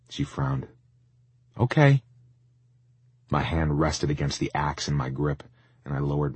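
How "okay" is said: mother's voice. A narrator's reading. Mom answers after frowning.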